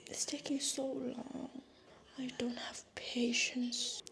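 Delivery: slow breathy whisper. Real, not acted. Waiting impatiently for her tea, a slow whisper. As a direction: murmur it under your breath, slowly.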